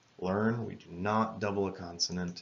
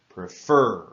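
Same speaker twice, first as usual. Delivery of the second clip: "Prefer" with FER said much louder, pre light and short; stress on the last syllable; 11 dB louder than usual. Shown as a demonstration. Teacher modelling word stress for students, loud final syllable on "Prefer". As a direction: punch the end of "Prefer" so students hear it. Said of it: "Listen to how the stress is at the end of that word".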